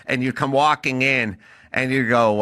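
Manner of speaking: ordinary talk, nothing marked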